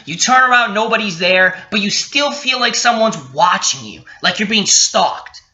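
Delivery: ordinary talk, nothing marked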